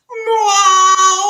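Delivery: high, loud crying meow; a whiny mock meow of a big lazy cat; fat cat meow. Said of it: "Maze meows like this".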